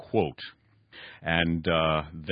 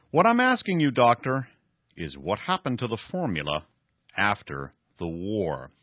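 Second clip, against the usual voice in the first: raised, emphatic story reading; dramatic novel reading; 4 semitones higher, 5 dB louder than usual. Acted. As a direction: read the villain's boast with drama. Reads novel passage aloud.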